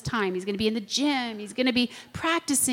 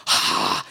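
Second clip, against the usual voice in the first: knowing "ah"; 4 dB louder than usual. Retold or imitated. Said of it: "And I'm like".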